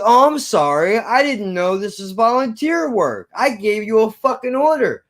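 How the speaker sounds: high-pitched mocking boss; sarcastic mock apology. Manager answering refusal of toilet duty, high sarcastic retort.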